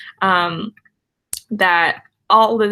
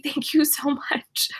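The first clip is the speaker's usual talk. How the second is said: breathless whispered thanks, slow; soft whisper; 5 semitones higher, 6 dB quieter than usual. Real, not acted. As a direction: whisper it, overwhelmed and touched. Overwhelmed by chat donations.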